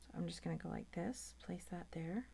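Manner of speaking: focused whisper while working; hushed under-the-breath whisper at the desk. Real, not acted. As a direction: whisper it to yourself as you place it. Whispering while placing piece.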